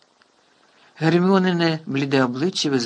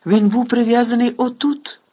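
barking in anger; 8 semitones higher, 5 dB louder than usual. A narrator's reading. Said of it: "розчулено гаркнув Кат".

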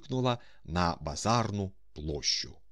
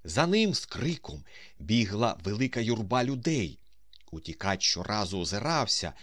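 plain reading voice

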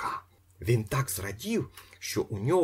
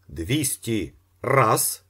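slow, loud, higher drawn call; auctioneer's call; 4 semitones higher, 6 dB louder, much slower than usual. A narrator's reading. Auctioneer announcing the new bid, slow and loud. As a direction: draw out the count like a gavel. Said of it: "сказав він".

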